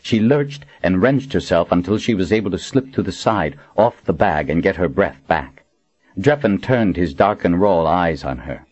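usual speaking voice

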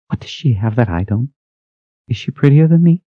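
rising into wounded jealousy; jealous young woman. A narrator's reading. Pasha jealous, hurt questioning.

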